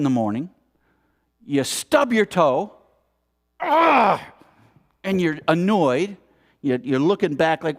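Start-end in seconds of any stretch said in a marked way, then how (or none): 3.6-4.2 pained yelp. Acted. Stubbing toe in the morning.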